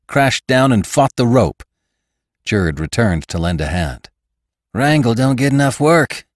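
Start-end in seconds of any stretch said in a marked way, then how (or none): 4.7-6.3 put-on higher-pitched boy's chatter; lightly voiced stable boy. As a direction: pipe up as a young stablehand.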